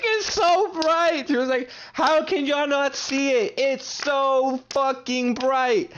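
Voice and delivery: high, slow, crying whine; mock-sob it out, pleading; imitated crying. Imitating tripping friend crying that it's bright. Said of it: "she started crying".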